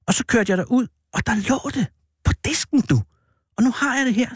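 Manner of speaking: high, animated storytelling; excited anecdote. Acted. Man showing a boy his new licence, excited.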